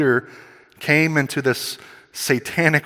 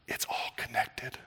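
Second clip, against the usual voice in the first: hushed aside, breath only; whisper; 13 dB quieter than usual. Real, not acted. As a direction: lean in and whisper it.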